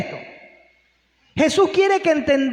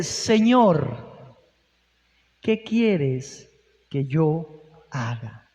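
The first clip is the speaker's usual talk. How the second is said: slow, fading lower, a mildly fearful Saul; trembling and fearful; 9 semitones lower, 3 dB quieter, much slower than usual. Retold or imitated. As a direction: ask the Lord meekly, sinking into a hush. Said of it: "temblando y temeroso".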